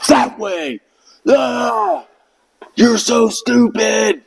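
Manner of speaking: high, slow, scornful shout; mock drill instructor. Drill instructor mocking the recruit, high scornful shout. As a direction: bark it out with contempt. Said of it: "He's like".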